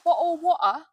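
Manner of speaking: loud, high put-on British accent on "Bottle of water"; mock British accent. Joking with friends, shouts in a mock British accent. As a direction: belt it out in an exaggerated British accent. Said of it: "That wasn't British".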